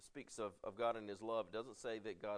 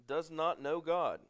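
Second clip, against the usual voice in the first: measured pulpit reading of scripture; 5 dB louder than usual. Acted. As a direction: read the Bible passage with weight. Reads scripture aloud to congregation.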